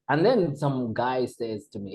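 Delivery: usual speaking voice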